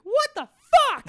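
put-on loud, squeaky child exclamation; Milo. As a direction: imitate a little kid swearing with full adult inflection. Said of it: "That's with the inflection".

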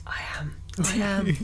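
playful whispered confession; mock whisper. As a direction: whisper it like a guilty secret. Joking confession of preaching.

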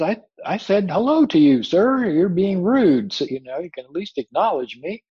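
high, loud acted scolding, easing at the end; Brer Rabbit's indignation. Ignored rabbit scolds a silent tar baby, high and indignant. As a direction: demand acknowledgement like an offended storybook rabbit.

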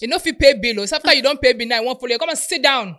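loud, high mocking scold; mock the man loudly; mocking retort. Friend scolding the man in mock outrage.

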